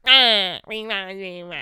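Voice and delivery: loud "Ah" then "wee, wah" babble; mock noises. Joking about how she really talks, silly babble. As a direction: make silly noises instead of words. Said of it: "being like".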